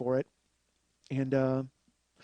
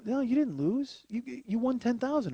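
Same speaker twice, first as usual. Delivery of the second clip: mock consoling family; 9 semitones higher than usual. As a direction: talk him down like family at the door.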